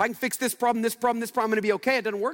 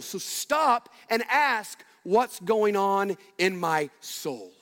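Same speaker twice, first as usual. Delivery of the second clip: slow deliberate; much slower than usual. Acted. Preacher urges listeners to stop.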